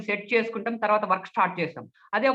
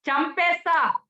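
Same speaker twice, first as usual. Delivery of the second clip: high-pitched menacing threat; threatening; 8 semitones higher, slower than usual. Shown as a demonstration. Speech trainer demonstrates how to threaten someone, menacing. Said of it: "బెదిరింపు".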